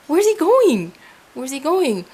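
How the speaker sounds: high, loud retold alarm; alarmed questioning. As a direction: act out a worried, high-pitched question. Alarmed at angel leaving.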